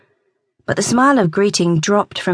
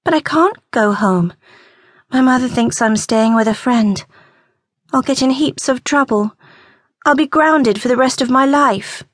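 higher, pleading, on the edge of tears; a young girl begging not to be sent home; lightly voiced upset teen; tearful teenage girl; 7 semitones higher than usual. A narrator's reading. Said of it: "Emma's eyes watered some more".

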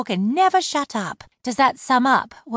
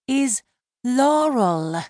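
My name, higher, spaced out and pressed; insistent repeat; 8 semitones higher, much slower than usual. Acted. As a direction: repeat it slowly so he can't ignore it. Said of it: "her chest heaved".

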